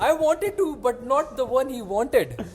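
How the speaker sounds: high, loud protest through laughter; slow it down and squeal through the laugh. Real, not acted. Teased storyteller defends himself to friend, high laughing protest.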